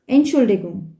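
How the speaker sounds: ENTSCHULDIGUNG as one smooth unit; whole word at once. Shown as a demonstration. Teacher modelling the word for habitual speakers, "Entschuldigung" in one unbroken go. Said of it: "pronounce the word in one time".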